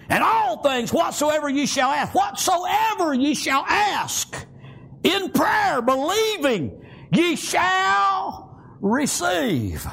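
emphatic sermon with stretched scripture words; fervent preaching. Real, not acted. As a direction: hammer home who said it. Preaching with force.

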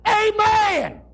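high, ringing holler of celebration; hollered amen. Retold or imitated. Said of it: "He hollers".

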